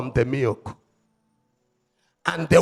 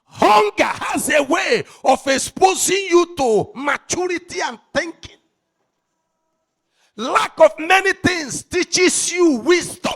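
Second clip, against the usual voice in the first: preaching at full force; 9 semitones higher, 8 dB louder than usual. Acted. Preacher roaring his message at the congregation, full force. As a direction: thunder it out to the congregation.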